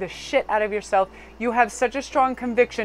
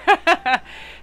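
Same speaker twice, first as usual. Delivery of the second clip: burst of laughter; 9 semitones higher, 7 dB louder than usual. Real, not acted. Laugh after hammer joke.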